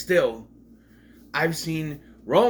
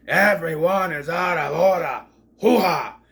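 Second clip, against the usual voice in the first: loud, theatrical mock tirade; hammed-up courtroom outburst; 4 semitones higher, 6 dB louder than usual. Vlogger parodying a courtroom speech, loud and hammed-up. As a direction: bellow it like an over-the-top movie lawyer.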